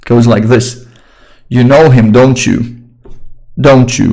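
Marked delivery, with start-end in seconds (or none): 1.5-2.8 "don't you" going from top to bottom; falling tag intonation. Shown as a demonstration. Teacher demonstrates how tag questions go down, falling tag intonation. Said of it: "It goes from top to bottom". 3.6-4.1 a question tag said like a statement, "don't you" falling; falling tag intonation. Shown as a demonstration. Teacher repeats the tag to show its tune, falling intonation. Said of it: "It goes from top to bottom".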